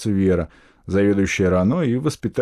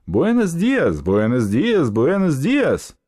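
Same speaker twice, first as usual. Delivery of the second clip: high, ringing Spanish greeting; loud repeated greeting; 7 semitones higher, 3 dB louder, much slower than usual. A narrator's reading. Spanish couple greeting everyone while shaking hands, loud and repeated. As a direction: repeat it heartily, pitched up, stretching the last one. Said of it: "громко повторяя на чужом языке".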